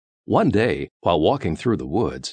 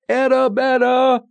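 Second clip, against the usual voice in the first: high, loud shouted name; yelling; 6 dB louder than usual. A narrator's reading. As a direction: yell for a missing friend. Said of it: "Tack, and Tock yelled".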